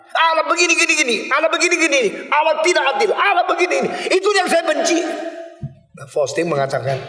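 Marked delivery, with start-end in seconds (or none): 0.1-5.0 angry rant. Retold or imitated. Young man rants to the pastor about unjust God, high angry shouting. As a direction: spit out every grievance.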